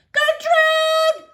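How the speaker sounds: high, loud screeching bird; parrot squawk. A narrator's reading. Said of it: "Gertrude squawked".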